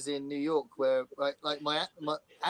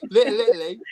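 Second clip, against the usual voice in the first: Little Italy louder, put-on American voice; mock American accent; 5 semitones higher, 10 dB louder than usual. Shown as a demonstration. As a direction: ham up Little Italy again like a New Yorker. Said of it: "that's the worst thing to say".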